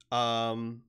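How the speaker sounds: ordinary talk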